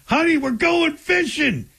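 high-pitched, drawn-out mock glee; hammed-up, high excited call; mock excitement. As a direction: pretend to be thrilled at a big win. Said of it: "did you jump up and down".

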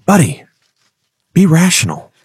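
an angel on the shoulder coaxing Greg to be rational; angel Donald Duck. Retold or imitated. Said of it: "the angel's on the other side saying".

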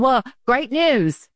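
high and drawn out, milking the suspense; slow dramatic reveal. Pretend. Boss revealing good news to nervous employees, slow teasing reveal. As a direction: stretch each word like a teasing announcer.

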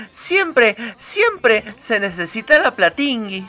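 high, cheery princess voice with silly -ingui words; bubbly kids' host character. Acted. Princess host chatting with co-hosts in a high, cheery voice. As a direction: keep up the playful princess persona.

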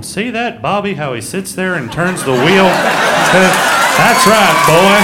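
loud, slow admiring dad voice; mock proud parent. Parent telling his son Bobby about the driver, mock awe. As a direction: gush over a NASCAR driver. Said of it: "parents at home going".

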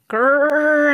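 loud, high growl of effort; straining growl. A narrator's reading. Baby Bird flaps hard to take off, straining growl.

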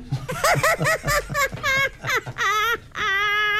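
high laughing fit; laughing at own joke. Real, not acted. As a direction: burst out laughing at own punchline.

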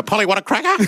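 high parrot voice; high-pitched cartoon macaw; talking macaw. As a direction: squawk it like a pet parrot. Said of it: "the macaws go".

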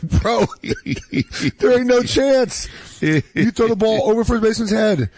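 high, incredulous protest through laughter; laughing disbelief. Retold or imitated. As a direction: scoff at the boast while cracking up. Dad mocks son's boast, laughing.

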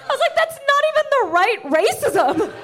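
incredulous. Retold or imitated. Exasperated comeback to the boss.

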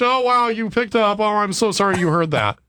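high, gushing mock apology; robocaller faking embarrassment. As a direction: imitate a staged flustered caller after a sneeze. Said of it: "should I say the robot on the other end".

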